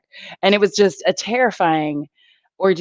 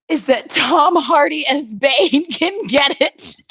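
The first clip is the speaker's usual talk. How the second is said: giggling through it, slow and high; laughing, a little embarrassed; laughing confession; 7 semitones higher, slower than usual. Real, not acted. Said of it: "what I'm saying".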